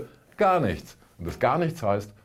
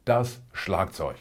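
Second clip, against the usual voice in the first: ordinary delivery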